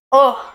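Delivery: real gag from the throat; sudden involuntary gag sound; gagging. Real, not acted. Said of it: "Sorry for gagging".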